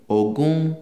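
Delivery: Ogun sung out on a high tone; Yoruba tone. Shown as a demonstration. Teacher demonstrating Yoruba tones to learners, high-tone Ogun. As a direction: lift Ogun up high, like the top note. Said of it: "the way you pronounce that letter".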